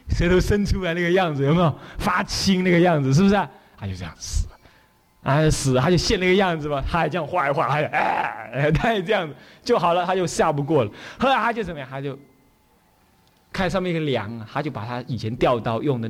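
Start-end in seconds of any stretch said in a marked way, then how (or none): none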